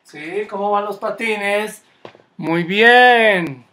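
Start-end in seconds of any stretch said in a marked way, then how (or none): 2.4-3.6 loud praise. Real, not acted. Coach praises skating kids.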